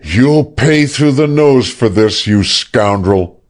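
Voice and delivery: high, whining threat with a whimper. A narrator's reading. Sexton, tied up, whimpering at Rondeau. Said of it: "Sexton whimpered".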